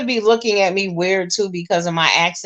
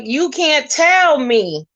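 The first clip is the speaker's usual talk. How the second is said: "You can't tell me" high and drawn out; incredulous complaint; 9 semitones higher, 4 dB louder, much slower than usual. Retold or imitated. Guest disputing the new hotel room, incredulous. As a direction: stretch "You can't tell me" slowly, pitched well up.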